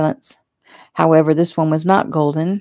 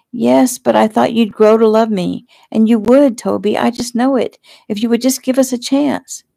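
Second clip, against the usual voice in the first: higher, louder urgent plea; pleading; 6 semitones higher, 4 dB louder than usual. A narrator's reading. Fiancée begs fiancé for a chance, pleading. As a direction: beg him to reconsider, insistently.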